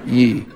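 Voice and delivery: Ye with lips kept back; Southern "Ye". Shown as a demonstration. Native correcting a Yankee, Southern pronunciation of Ye. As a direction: say Ye low, Southern style. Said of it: "Don't have to push your lips out".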